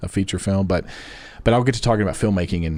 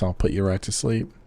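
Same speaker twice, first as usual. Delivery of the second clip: ordinary talk, nothing marked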